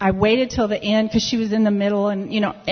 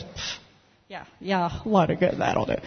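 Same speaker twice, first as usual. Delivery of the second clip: low, soft retold brush-off; dismissive scoff; 3 semitones lower, 7 dB quieter than usual. Woman brushing off an offer of prayer, dismissive. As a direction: puff and wave the offer away, quietly.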